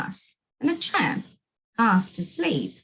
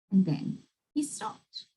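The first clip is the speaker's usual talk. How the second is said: usual speaking voice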